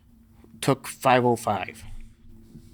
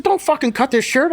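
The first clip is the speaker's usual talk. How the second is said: much higher, louder protest; defiant yell; 13 semitones higher, 5 dB louder than usual. Retold or imitated. Injured lifter yelling at Doug Heath to spare his shirt, loud protest. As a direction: yell to refuse. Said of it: "I said".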